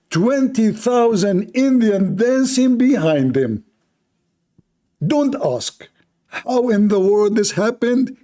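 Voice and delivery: slow, high mock amazement. Host mocking Indian movie dancers, slow high amazement. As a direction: spin it as a ridiculous movie twist.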